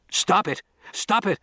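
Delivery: high, frantic yells; frantic shouting. A narrator's reading. Flames destroy the specimen.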